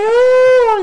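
loud high wailing scream; mock scream. Slapped stepmom falls to the ground, screaming. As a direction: imitate a long shrieking wail. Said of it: "she's all screaming and crying".

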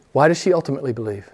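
ordinary delivery

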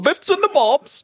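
high, playful Cockney echo; mock Cockney accent. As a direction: toss it back in high fake Cockney. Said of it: "That's not even a Cockney accent".

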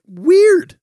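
very high, loud squeal of fake wonder; mock surprise. Ex-smoker noticing the anxiety gone, mock surprise. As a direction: squeal it in sarcastic amazement.

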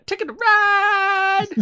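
high, slow, drawn-out yell; excited shout. Real, not acted. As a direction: belt the title out. Shouting the game name.

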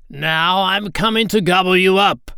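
loud, high troll roar. A narrator's reading. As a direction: threaten at full roar as the troll. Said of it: "roared the troll".

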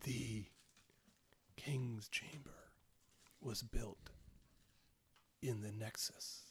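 very soft, slow ghostly whisper; hushed undead voice, long pauses; creepy corpse whisper. Acted. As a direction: whisper haltingly from the grave. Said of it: "And it's really creepy".

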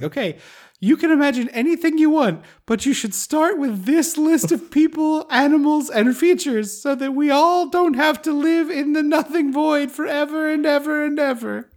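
high, lilting mock royal child; high pitched playful impression of a child queen; mock child ruler voice. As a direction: talk up high like a grand little empress. Voicing the magical child ruler.

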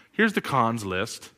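ordinary talk, nothing marked

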